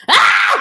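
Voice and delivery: sudden high mock shout; loud high yelp imitating the sweaty pastor; mock yell. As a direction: burst out like the screaming pastor. Mocking pastor yelling.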